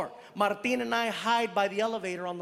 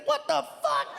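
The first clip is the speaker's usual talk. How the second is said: very high startled cry; sudden shock at the card; high disbelieving yelp; shocked outburst. Retold or imitated.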